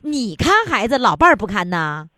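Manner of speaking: ordinary delivery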